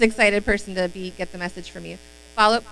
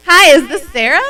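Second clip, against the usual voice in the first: much louder, higher greeting broken by laughter; laughing through it; 6 semitones higher, 14 dB louder than usual. Shown as a demonstration. Fixing the phone script while still laughing, loud and high. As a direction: try the line while still giggling.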